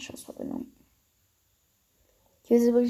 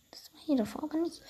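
whisper; 6 semitones higher, 7 dB quieter, faster than usual. Real, not acted. Muttering to self while gaming.

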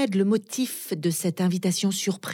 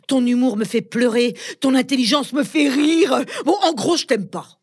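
louder and higher insults; loud mocking; 6 semitones higher, 6 dB louder than usual. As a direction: build up to shouting. Escalating insults.

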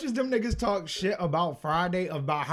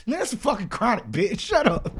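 higher-pitched comic outburst climbing to the end; mock exasperated scolding; 7 semitones higher, 4 dB louder than usual. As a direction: act fed up and shut the dreamer down. Acts heckler rejecting album idea.

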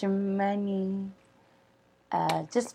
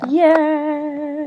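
excited; 8 semitones higher, 6 dB louder than usual. Real, not acted.

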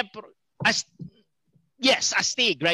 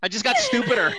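laughing; 12 semitones higher than usual. Real, not acted. Laughing joke.

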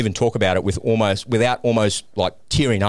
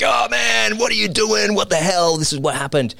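much higher, shocked outburst; 12 semitones higher than usual. Retold or imitated. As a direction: voice leaps up in alarm. Mate Paul shocked, retold.

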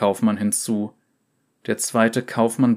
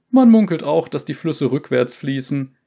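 loud higher mocking jab; 5 semitones higher, 6 dB louder than usual. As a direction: scoff at foreign superstition. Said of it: "machte sich ... lustig".

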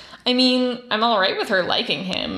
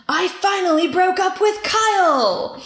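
all-caps excitement; 10 semitones higher, 4 dB louder than usual. Retold or imitated. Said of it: "All in capital letters".

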